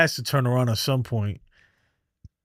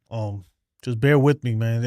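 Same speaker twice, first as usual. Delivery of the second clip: ordinary delivery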